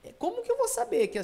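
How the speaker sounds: hesitant high student interjection; questioning student. Acted. Imagined student asks the teacher a question, questioning. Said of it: "imaginar a sua pergunta".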